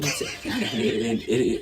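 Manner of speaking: ordinary talk, nothing marked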